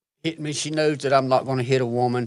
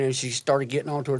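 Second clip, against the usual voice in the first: usual speaking voice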